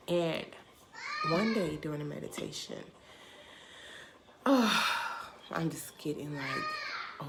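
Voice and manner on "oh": big, breath-heavy "oh"; overwhelmed sigh. Real, not acted. Storyteller overcome by her own meditation story, overwhelmed sigh. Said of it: "overwhelmed and feeling like".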